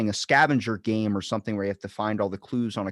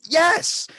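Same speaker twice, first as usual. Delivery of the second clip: a high, loud yes; excited yes; 8 dB louder than usual. Retold or imitated. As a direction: burst out with it. Admitting it to grandfather.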